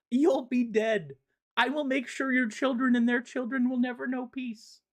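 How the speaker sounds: hammed-up villain rant, high and delighted; gloating villain. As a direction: crow it like a giddy immortal who just wins by waiting. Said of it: "So Mogul's like".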